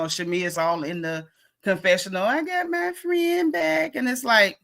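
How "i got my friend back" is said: high gushing voice. Retold or imitated. Imitating Shamea in confessional.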